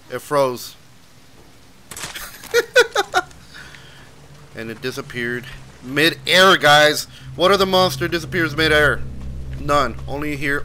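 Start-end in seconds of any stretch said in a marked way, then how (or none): 1.9-4.5 breathless high laugh; high wheezy laugh. Real, not acted. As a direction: burst out laughing. Monster froze mid-air.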